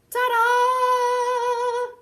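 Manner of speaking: high, loud sung ta-da; triumphant ta-da. Acted. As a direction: belt it out like a showman. Groundhog pops out of hole.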